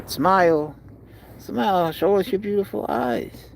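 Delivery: high, cooing invitation; coaxing pet talk. Real, not acted. Owner wants cat to show eyes, high coaxing.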